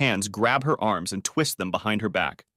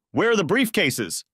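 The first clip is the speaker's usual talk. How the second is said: shouted; 9 semitones higher than usual. A narrator's reading. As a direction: shout it in her face, frantic. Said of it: "he shouted".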